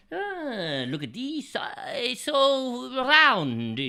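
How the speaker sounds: high, very slow loud admiring coo; delighted coo. Acted. Chef marvels at his round dough, slow and high. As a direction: marvel at it, stretching it out.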